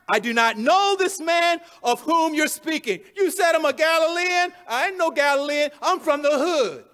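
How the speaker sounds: loud, high, punchy protest; acted outrage, forcefully disowning; swearing denial. Peter denies being a Galilean to his accusers, angrily. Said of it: "Then he began to curse and swear".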